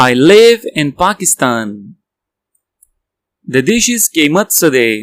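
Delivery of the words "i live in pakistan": full sentence slowly; slow whole sentence. Shown as a demonstration. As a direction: join it up slowly. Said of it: "whole again".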